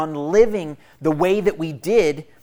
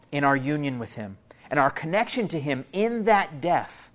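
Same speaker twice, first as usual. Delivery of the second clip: ordinary delivery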